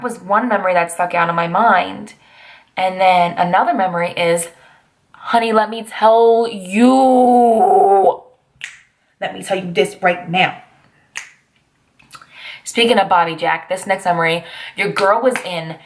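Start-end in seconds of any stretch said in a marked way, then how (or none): 5.1-10.6 drawn-out sassy "honey, let me tell you". Pretend. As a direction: lean in like a gossiping auntie. Sassy aside to the viewers.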